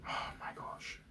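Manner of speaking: breathless whispered oh my gosh; whispered aside. Real, not acted. Reacting to his date's gloomy answer, whispered aside.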